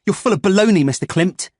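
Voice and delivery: put-on boy angrily dismissing the android; defiant. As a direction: throw the word baloney at him.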